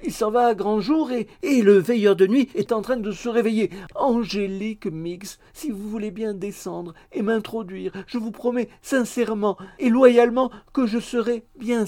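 pleading suitor, urgent. A narrator's reading. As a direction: coax her with solemn promises. (soupirant pressant, voix aiguë et suppliante)

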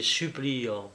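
ordinary delivery, nothing marked

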